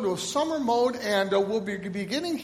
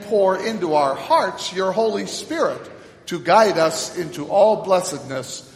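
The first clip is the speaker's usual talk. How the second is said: louder, slow, solemn prayer; public prayer; 7 dB louder, slower than usual. Acted. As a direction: pray aloud for the whole congregation, slowly. Leads collect prayer aloud.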